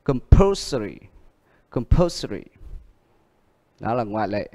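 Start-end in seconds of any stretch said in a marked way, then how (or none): 0.0-2.4 compulsory with the stress on PUL; com-PUL-sory, second syllable stressed. Shown as a demonstration. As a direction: model compulsory twice, leaning on PUL. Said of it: "được nhấn ở âm poor".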